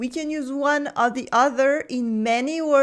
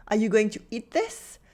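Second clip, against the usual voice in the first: usual speaking voice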